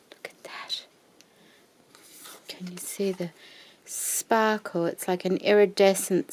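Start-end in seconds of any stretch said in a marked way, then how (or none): 0.1-0.8 whisper=40. Real, not acted. Whispering to viewers.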